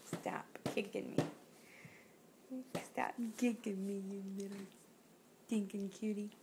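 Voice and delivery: hushed teasing scold, barely voiced; playful whisper. Real, not acted. Parent to kicking toddler, soft playful whisper. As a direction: whisper it like a fond tease.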